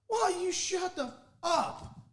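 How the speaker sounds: high, slow mock snap; mock pitcher snapping back. Pitcher turning to call out the left fielder, high mock snap. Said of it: "and goes".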